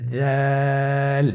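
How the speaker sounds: দাল held long for three alif lengths; দাল drawn out long, a teacher's model; drawn-out letter. Shown as a demonstration. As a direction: stretch দাল slowly and evenly. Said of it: "আবার বলুন".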